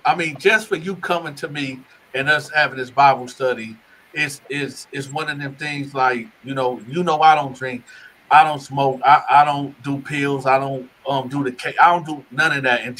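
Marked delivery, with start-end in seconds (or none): none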